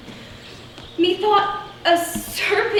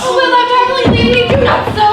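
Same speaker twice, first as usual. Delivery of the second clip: pleading loud; 7 semitones higher, 10 dB louder, much faster than usual. Acted. Hermia begs Demetrius to stay.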